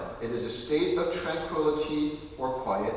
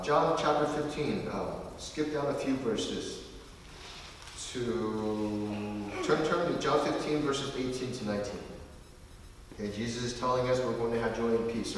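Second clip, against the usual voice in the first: ordinary talk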